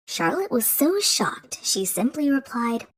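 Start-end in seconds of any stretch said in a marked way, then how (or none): none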